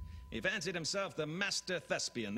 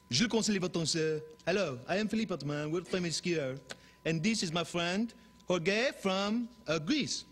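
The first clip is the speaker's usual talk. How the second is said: put-on French accent; 4 semitones higher than usual. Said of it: "I can also do any dialect".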